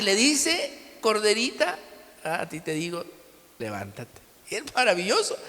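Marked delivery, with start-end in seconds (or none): 1.0-4.2 tierno, mucho más suave al final; con cariño y autoridad. Retold or imitated. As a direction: como despertando con amor a una niña. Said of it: "con cariño y con autoridad". (con ternura, cada vez más suave)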